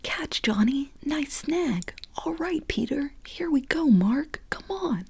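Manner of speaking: playful calling. Acted. Boys calling during football game.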